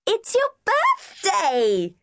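put-on very high, slow child's shout; little girl roaring. A narrator's reading. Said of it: "Debbie roared even more loudly".

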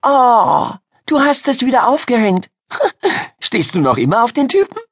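put-on high, giggly girl's voice; excited teasing girl. Miriam spotting Ida's vampire poster, excited. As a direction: squeal and tease your friend.

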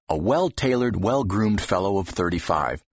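ordinary talk

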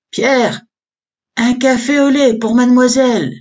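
high, loud French call; French accent. A narrator's reading. Hostess calling to Pierre at the bar, French accent. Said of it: "in a thick French accent".

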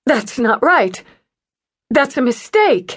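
refusing to accept the news; woman denying news of a death, high disbelief; shocked denial. A narrator's reading. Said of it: "faking the shock and denial".

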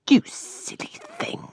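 high, whispery self-reproach; scolding. A narrator's reading. Miss Moss to the face in the glass, whispery scolding. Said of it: "scolded Miss Moss".

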